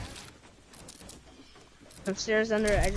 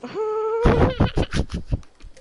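loud high burst of laughter; big laugh; 11 semitones higher, 6 dB louder than usual. Real, not acted. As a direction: laugh out hard. Friend laughing at the game.

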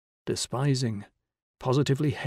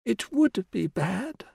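put-on old woman whispering; 8 semitones higher, slower than usual. A narrator's reading. Dying old woman voiced.

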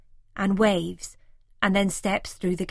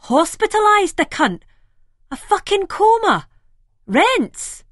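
much higher and louder, gasping disbelief; shocked gasp; 12 semitones higher, 8 dB louder than usual. A narrator's reading. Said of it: "Then gasps".

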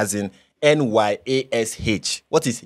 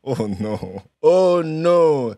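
shocked disbelief; 5 semitones higher, much slower than usual. Real, not acted. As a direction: react like she said something awful. Reacting in disbelief.

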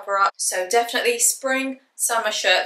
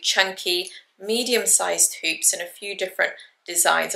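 ordinary talk, nothing marked

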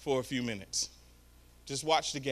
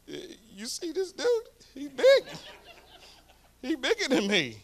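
higher, hammed-up kid voice with a little laugh; doubtful boy; 9 semitones higher than usual. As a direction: play the boy sizing up the big guy. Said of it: "looked at his granddaddy like".

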